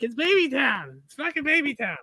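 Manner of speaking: high, emphatic imitation of dad; mock dad insisting; insistent dad. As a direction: insist like a stubborn father. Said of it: "And he's like".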